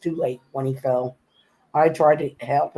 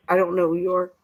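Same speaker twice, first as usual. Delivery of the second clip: ordinary talk, nothing marked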